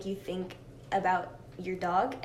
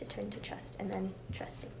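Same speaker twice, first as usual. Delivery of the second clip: whispered aside; 9 dB quieter than usual. Real, not acted. Whispered aside in word history.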